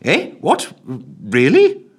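high, startled secretary; flustered surprise. Acted. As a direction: caught out and taken aback. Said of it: "muttered the secretary".

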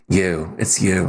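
low, slow seductive purr; breathy seductive delivery; sexy voice. Pretend. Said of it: "my attempt at a sexy voice".

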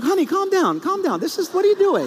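high-pitched imitation of a startled wife; alarmed wife. Wife trying to calm raging husband, high and flustered. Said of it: "my wife is going".

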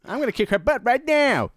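mock Sable. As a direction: parody an angry diva pouting her lines. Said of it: "continues Sable impression".

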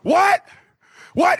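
high-pitched shouted "what?"; incredulous, pitch leaping way up; mock outraged disbelief. Mock outrage at interviewer's question.

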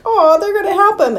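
high, sympathetic aww voice; imitated naive tenderness; mock cooing. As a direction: coo as if over a hurt baby. Crew cooing over hurt baby.

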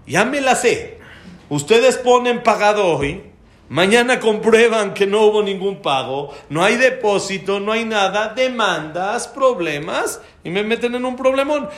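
high, loud angry accusations; cliente enojado imitado. Retold or imitated. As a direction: rant like a fed-up, distrustful customer. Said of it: "el señor sigue enojado". (cliente furioso reclamando a voces)

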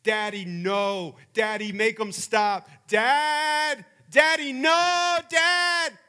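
put-on child crying in terror at the dentist; screaming crying child. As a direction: wail it like a terrified kid begging his dad. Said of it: "he screamed and he cried".

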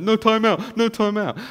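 put-on high, loud whiny child protest; imitating a toddler begging not to be put on time out. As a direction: plead like a small boy dreading time out.

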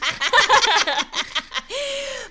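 long, high-pitched laughing fit; burst of laughter. Real, not acted. Sisters joke about his chest, high laughter. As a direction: crack up in high peals.

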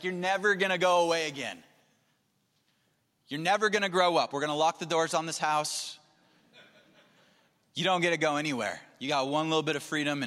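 loud, hammed-up fatherly decree; overprotective parent. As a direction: lay down the law as a frightened parent. Acted overprotective father to daughter.

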